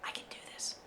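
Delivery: very soft acted pep talk; hushed, imagined private resolve; whispered self-encouragement. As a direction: psych yourself up under your breath. Voices actor psyching himself up.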